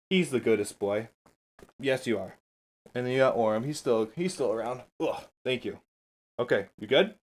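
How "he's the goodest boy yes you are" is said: soft, doting praise for a pet; doggy praise. Real, not acted. Praising his dog.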